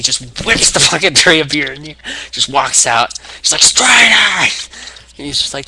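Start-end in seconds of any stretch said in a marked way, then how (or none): 3.6-4.5 much higher, louder hammed-up scream; mock furious yell. Wrestler losing it in the arena, mock furious yell. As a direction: throw the name out like a war cry.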